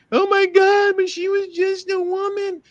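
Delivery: very high, mocking falsetto whine; mocking whiny voice. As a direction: mimic them crying victim in a shrill put-on voice. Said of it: "Now all of a sudden".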